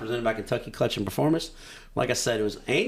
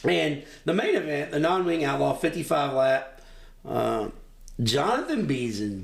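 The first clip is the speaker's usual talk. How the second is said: ordinary talk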